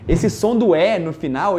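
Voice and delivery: ordinary talk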